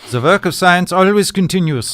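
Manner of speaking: put-on loud, grand German accent; a lofty pronouncement in a German accent; German scientist accent. German scientist lecturing Rose on science, German accent. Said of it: "the German scientists refuse to let her in".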